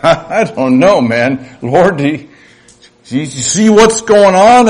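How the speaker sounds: doubting plea. Acted. Voices a doubter questioning God.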